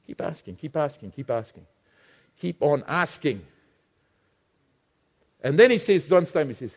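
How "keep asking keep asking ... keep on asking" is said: softer, lower, coaxing repetition; soft urging. Acted. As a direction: gently press them to keep asking. Soft repeated exhortation.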